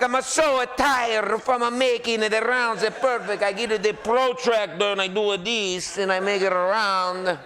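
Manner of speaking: slow, high hammed-up Italian accent, like a weary pizza chef. Teasing the pizza maker about perfect rounds, mock Italian accent. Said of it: "That's an Italian accent".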